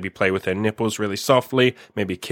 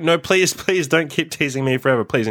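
higher-pitched imitated begging; mock pleading; 5 semitones higher than usual. Imitates partner pleading to him.

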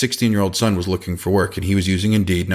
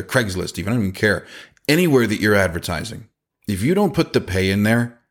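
normal talking voice

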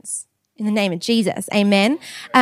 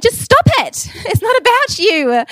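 much higher and louder, with a laugh; mock scolding; 12 semitones higher, 4 dB louder than usual. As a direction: playfully scold the church. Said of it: "Lorette is talking to you today going".

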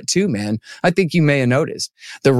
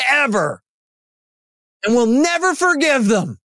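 hyperbolic Trump quote; 9 semitones higher than usual. Retold or imitated. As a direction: lay on the hyperbole like a showman. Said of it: "He goes".

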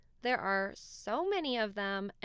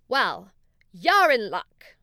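loud, bright unicorn reply; 11 dB louder than usual. Acted. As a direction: boom it out happily as the unicorn. Unicorn answers the bunnies.

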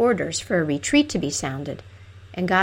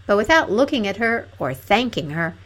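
ordinary delivery, nothing marked

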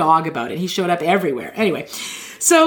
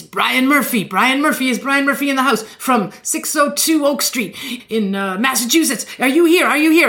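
high, hammed-up preacher calling out a name; 6 semitones higher than usual. Healer calls a stranger by name and address, high hammed-up preacher. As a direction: boom a name across the arena like a showman.

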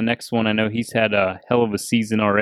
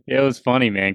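ordinary delivery

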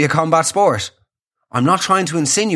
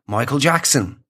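ordinary talk

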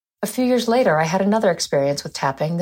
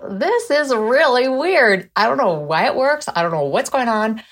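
baffled; 5 semitones higher than usual. Retold or imitated. Quoting her own baffled thought.

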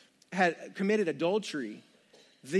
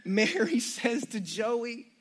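usual speaking voice

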